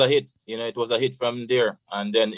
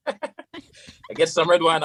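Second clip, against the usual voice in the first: slow and higher, laughing through the words; 7 semitones higher, much slower than usual. Real, not acted. Retelling the red wine story to the host, laughing. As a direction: laugh it out as you repeat it. Laughs while repeating line.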